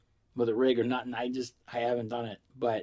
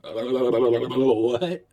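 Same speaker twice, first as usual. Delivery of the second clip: incredulous; 6 dB louder than usual. Real, not acted. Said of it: "reacting to the quote".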